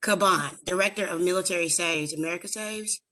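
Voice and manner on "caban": Caban said "kuh-BAHN"; Caban repeated as corrected. Shown as a demonstration. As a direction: echo Caban with stress on the long "ah" ending. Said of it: "It's Caban".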